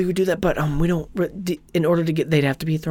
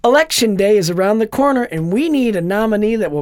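hammed-up chirpy campaign volunteer; mock campaign pitch, higher; campaign text read; 5 semitones higher, 5 dB louder than usual. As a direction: read it like a cheerful canvasser. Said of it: "I just got a text message".

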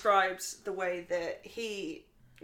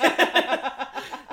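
burst of laughter; 7 semitones higher, 9 dB louder than usual. Real, not acted. Keeps laughing.

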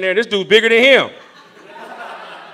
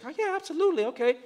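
soft, high imitated woman's voice; gracious; 8 semitones higher, 10 dB quieter, faster than usual. Woman on exercise bike welcomes pastor's word, gracious. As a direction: lightly imitate a gracious woman agreeing softly. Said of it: "She was very gracious".

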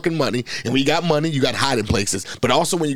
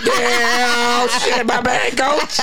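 caught player apologizing; 6 semitones higher, much slower than usual. Retold or imitated. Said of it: "Jason Tatum was like".

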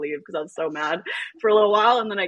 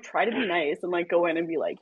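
ordinary talk

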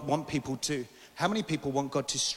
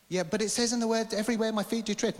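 higher-pitched mock protest; protesting objection; 7 semitones higher than usual. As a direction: play the believer arguing back.

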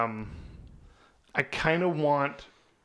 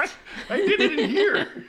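laughing; 5 dB louder than usual. Real, not acted. Joking about sleepwalking, laughing.

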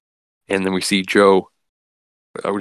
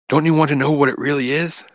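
high, frantic snarl while throwing punches; a mock crazed attacker; hammed-up fury, pitched up; berserk rage; 7 semitones higher than usual. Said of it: "going berserker".

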